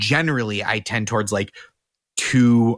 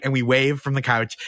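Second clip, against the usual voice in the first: usual speaking voice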